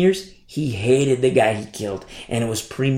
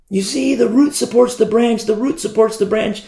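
higher, pressing on root; emphatic retort; 9 semitones higher than usual. Retold or imitated. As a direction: drive home the word root in a raised voice. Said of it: "I've had these conversations with the Hebrew roots".

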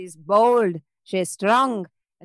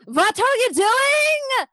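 high, slow, exasperated yell; alarmed shout; 4 dB louder, much slower than usual. Real, not acted. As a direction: shout at the reckless player. GM shouts at player's tremor.

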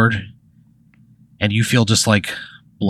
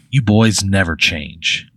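slow weary remark; slower than usual. Acted. Teacher sighs at boys.

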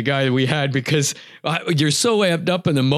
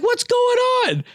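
high squeak of disbelief, pitch jumps way up; can't believe the goose dropped; baffled surprise. Retold or imitated. Hunter to buddy, squeaking in disbelief.

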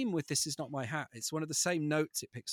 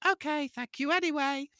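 much higher, small put-on creature voice; a meek, polite thank-you; polite little animal; 12 semitones higher, 4 dB louder than usual. Father voicing the hatless animal to his kids, high and meek. Other animal in book replies.

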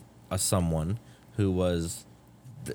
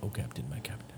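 whispered quote; 4 semitones lower, 11 dB quieter than usual. Pretend. Joking movie quote whispered.

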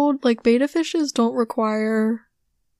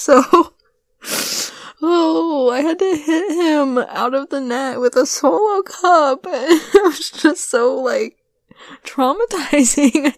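slow, high, laugh-crying delivery; 4 semitones higher, 4 dB louder, slower than usual. Real, not acted. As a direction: laugh through the words helplessly. Laughing while retelling hitting fish.

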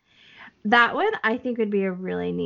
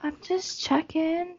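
playing dumb; 8 semitones higher, 6 dB quieter than usual. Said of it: "I'm playing stupid".

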